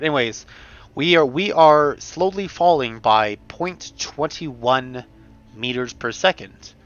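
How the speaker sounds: ordinary delivery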